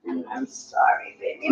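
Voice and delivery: soft whisper. Real, not acted. Soothing baby softly.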